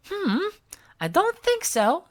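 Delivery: put-on high, lighter girl's voice; a scornful little girl; Smudge voice. A narrator's reading. Smudge scoffing at the tree, high and sour. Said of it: "Smudge said".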